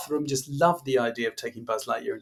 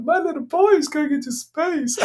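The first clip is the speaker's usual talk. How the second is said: high, slow, wailing mock sob; hammed-up tearful wail; mock weeping; 14 semitones higher than usual. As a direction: play the proud parent in tears. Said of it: "I was weeping like".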